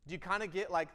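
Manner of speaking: usual speaking voice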